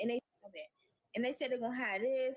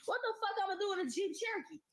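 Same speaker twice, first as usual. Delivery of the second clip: pitched up in disbelief; outraged; 8 semitones higher than usual. Real, not acted. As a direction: scoff at the Jeep, high and indignant. Outraged at the rental car.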